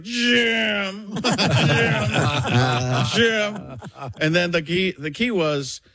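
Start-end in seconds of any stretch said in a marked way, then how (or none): 0.0-3.8 revving engine Romo voice; Romo revving like a race car. Retold or imitated. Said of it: "The nascar race be like".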